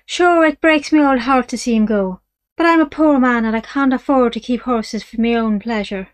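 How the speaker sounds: a sly dealer pretending to be sad; mock-sorrowful Irish dealer. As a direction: play the poor man for sympathy.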